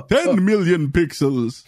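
slow, counting with a mock vampire relish; hammed-up Count voice, slow and drawn out. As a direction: count the broken doors like the Sesame Street Count. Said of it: "'cause the count works there".

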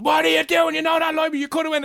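high, loud mock screaming guard; imitating a shouting motorbike garda; angry guard yell. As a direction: bark it like a furious cop. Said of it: "starts screaming at me".

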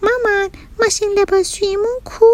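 put-on high little-kid voice; child asking mom. A narrator's reading. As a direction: ask mom like a puzzled small child. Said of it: "از مامانش پرسید". (صدای نازک بچه، پرسشگر)

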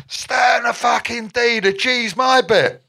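high, slow shouted order; angry shout. Retold or imitated. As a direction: bark it like an angry bandmate. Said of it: "he turns around".

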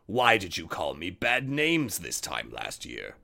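low menacing wolf. A narrator's reading. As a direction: growl it as the wolf. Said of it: "said the wolf".

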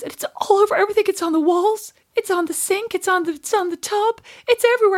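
put-on high-pitched frantic child; hysterical freak-out. Twelve-year-old reporting the poo upstairs, high and hysterical. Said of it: "hysterically laughing and almost, like, freaking out".

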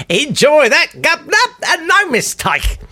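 squeaky high cartoon Londoner; silly high voice. Comic at London listeners, squeaky high cartoon voice. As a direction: pipe it up like a daft cockney. Silly cockney jellied eels bit.

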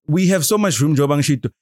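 ordinary talk

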